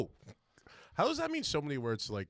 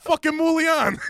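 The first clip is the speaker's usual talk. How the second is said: laughing mock accent; 7 dB louder, slower than usual. Mimics Italian pronunciation, laughing.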